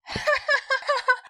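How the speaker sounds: high burst of laughter; class laughing. A narrator's reading. The whole class bursts out laughing at the rumbling. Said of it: "同学们大笑".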